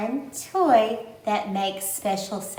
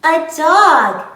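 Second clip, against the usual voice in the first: put-on broad singsong kids theatre; 5 semitones higher, 13 dB louder than usual. Excited reveal to toddlers.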